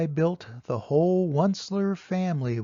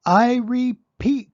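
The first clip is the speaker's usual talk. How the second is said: high, loud and slow insistence; cried out; 6 semitones higher, 10 dB louder, slower than usual. Acted. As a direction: cry it out, slowly and firmly. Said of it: "cried the Lorax".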